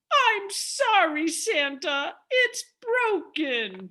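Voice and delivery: put-on high, slow, tearful child's voice; tearful little girl. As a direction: apologize near tears. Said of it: "Tears sprung to my eyes".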